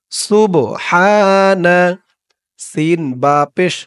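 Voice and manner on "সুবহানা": সুবহানা drawn out slowly in a chant; slow recitation. Shown as a demonstration. Teacher shows the Arabic word slowly, chanted. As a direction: stretch সুবহানা long and even. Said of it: "চলুন আগে শিখি আমরা আরবিতে".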